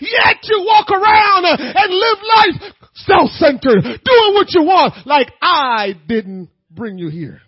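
loud, high, rising preacher's cry; full-force preaching. Acted. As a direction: preach it hard to the congregation.

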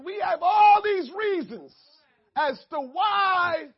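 loud, high preacher's cry; full-force preaching. Acted. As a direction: preach it out to the congregation. Preaching resumes.

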